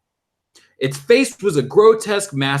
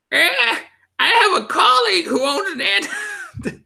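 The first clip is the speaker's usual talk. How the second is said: put-on much higher, wavering old man. Shown as a demonstration. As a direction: try out a creaky old man voice. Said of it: "No, I'm not gonna do that".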